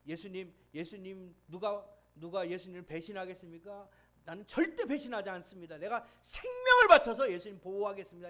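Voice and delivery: earnest vow building to a loud cry; vow of loyalty. Retold or imitated. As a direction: swear it, rising until you nearly shout. Peter vowing loyalty to Jesus.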